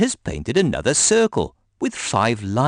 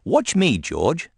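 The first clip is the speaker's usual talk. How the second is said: higher bossy big sister; Peppa bossy; 7 semitones higher, slower than usual. A narrator's reading. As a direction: show him who knows best. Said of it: "snorts Peppa".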